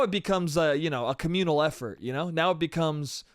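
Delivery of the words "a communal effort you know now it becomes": ordinary talk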